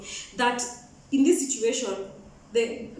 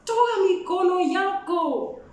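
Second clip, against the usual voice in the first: higher, drawn-out annoyed order; slow, whiny-high push-back; annoyed protest; 7 semitones higher, slower than usual. Retold or imitated. Woken sleeper to someone touching her, annoyed protest.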